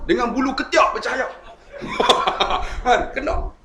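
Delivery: laughing. Real, not acted. Preacher joking about glowing armpit hair, laughing. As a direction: giggle through the punchline.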